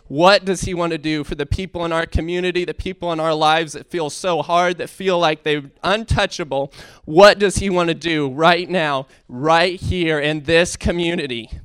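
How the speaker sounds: rally the congregation with rising fervour; preaching at full force. Acted.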